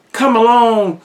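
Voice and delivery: ordinary delivery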